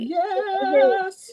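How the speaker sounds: loud, very high celebratory yes; cheering. Real, not acted. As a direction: cheer a friend's good news, voice soaring. Cheering friend's passed test.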